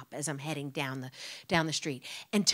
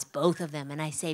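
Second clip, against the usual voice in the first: normal talking voice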